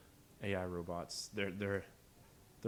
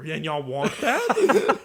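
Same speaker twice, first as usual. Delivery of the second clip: loud, high, slow laughing question; 7 semitones higher, 14 dB louder, much slower than usual. Retold or imitated. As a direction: laugh it out in disbelief. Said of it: "I was just like".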